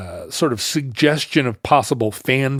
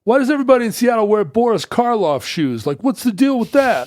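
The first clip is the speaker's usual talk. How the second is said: put-on high, loud New York accent; raised, loud New York accent; New York guy; 11 semitones higher, 4 dB louder than usual. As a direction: complain like a brash New Yorker at the counter. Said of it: "he was like".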